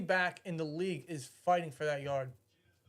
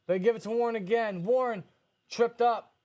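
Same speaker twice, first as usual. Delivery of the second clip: excited; 6 semitones higher, 4 dB louder than usual. Real, not acted. Live play-by-play excitement.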